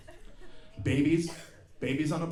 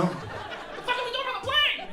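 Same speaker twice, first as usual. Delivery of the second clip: much higher, squeaky panicked voice; mock dog voice. Therapy dog on the plane, in a high panicky squeak. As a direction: squeak it like a freaked-out little dog.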